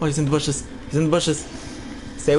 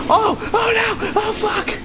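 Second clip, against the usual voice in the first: high panicked yell; panicked shout; 11 semitones higher than usual. Real, not acted. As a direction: cry out in alarm. Monster attacks.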